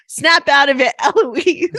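loud, high playful order; mock command. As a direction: call it out sharply, half laughing. Coaching child to confront Eloise.

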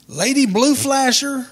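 high, loud, drawn-out hail; calling out. Acted. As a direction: call out slowly across the yard, pitched high. Addressing neighbor by her CB handle.

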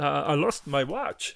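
slow mumbled Mister Bean impression. Friend asked if he does Mister Bean, answers in a slow mumbled Bean voice. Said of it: "or does he do Mister Bean?".

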